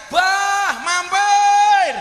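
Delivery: high, loud sing-song call with a laugh; women calling out. Retold or imitated. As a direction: call him over from the roadside. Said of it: "langsung teriak".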